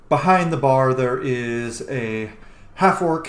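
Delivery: ordinary delivery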